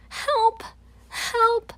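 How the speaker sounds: high, loud shouts for help; yelling too. Acted. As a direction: join in the yell, calling out. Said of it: "I yell too".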